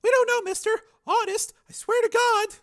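put-on very high, loud pleading girl; terrified girl. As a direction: shriek in terror. Frightened girl.

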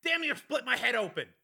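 high, strained rant at reduced volume. A narrator's reading. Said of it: "continued at a slightly lower volume".

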